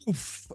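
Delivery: soft imitated ignition noise; airy mouth-made fire effect; whooshing flame burst.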